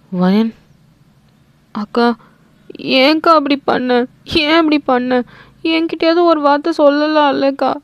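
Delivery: high, pleading tearful voice; crying sister. A narrator's reading. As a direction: plead through tears with her sister. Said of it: "அழுவுறா".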